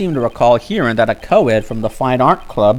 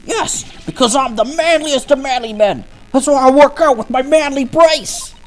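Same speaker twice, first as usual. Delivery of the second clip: put-on high, straining teenage boast; squeaky boy proclaiming his manliness; cracking would-be manly voice; 13 semitones higher, 3 dB louder than usual. Student boasting of manliness to the lawyer, high cracking voice. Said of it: "I hear some discord in that manliest of men's voice".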